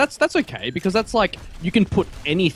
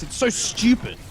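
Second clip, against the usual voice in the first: ordinary talk, nothing marked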